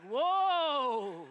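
loud, laughing whoa; a pig alarmed at the ham idea; startled pig. Retold or imitated. Startled pig blurts a loud laughing whoa. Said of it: "And the pig's like".

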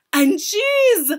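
high, loud exclamation; witch's shout. A narrator's reading. Witch adds to her plan, shouting. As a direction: shout it like the final flourish. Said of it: "shouted the Grand High Witch".

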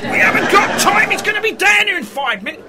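high, exasperated objection; urgent protest. Acted. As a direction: cut in urgently, pitch jumping up. Urgent protest before Harris arrives.

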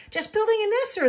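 high-pitched little bird voice; high, perky storybook bird; chirpy bird. A narrator's reading. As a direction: pipe up brightly as a small bird. Bird answers moose in story.

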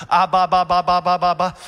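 loud, hammed-up fake babbling; loud mocking ba-ba babble; mock tongues. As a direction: parody students faking charismatic tongues. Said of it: "I know they were faking praying in tongues".

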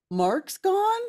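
high, stunned disbelief; shocked question. Retold or imitated. Host reading the group chat before going onstage, stunned and high. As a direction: gasp it out in shock.

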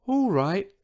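high, small, hushed-style voice; meek, high little reply; tiny whisper. A narrator's reading. Huge dinosaur agrees to tiptoe, in a tiny mock whisper. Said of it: "whispered the dinosaur".

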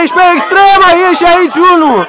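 high, urgent positioning shout; calling out. Real, not acted. Coach places a player on the wing, calling out. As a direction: shout the position across the court.

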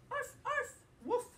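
high, loud little arf; puppy yip. Acted. Teacher voicing the little pup for children, loud squeaky yip. Dogs bark in the story.

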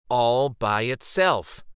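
usual speaking voice

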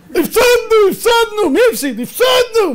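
high, loud crying shout; football fan wailing that we lost, high and loud. Retold or imitated. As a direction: howl over the lost game. Fan wailing over the loss.

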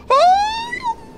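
a sudden, very high shriek of delight; excited yelp. Real, not acted. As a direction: yelp with real surprise as the shot lands. Sudden shot lands in game.

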